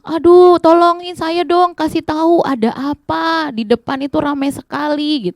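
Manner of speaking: high-pitched acted shout for help; berteriak minta tolong. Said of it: "Dia teriak". (seruan minta tolong bernada tinggi)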